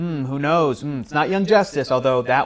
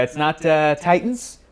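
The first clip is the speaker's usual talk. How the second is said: ordinary talk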